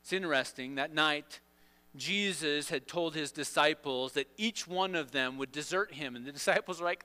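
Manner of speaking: ordinary talk, nothing marked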